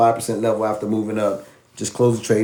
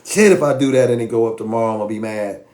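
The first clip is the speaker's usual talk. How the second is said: usual speaking voice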